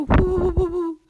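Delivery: loud, high wavering "woo"; howling wind. Acted. Storyteller answers as the North Wind, howling. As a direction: blast it out like gusts of wind. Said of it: "the winds were howling".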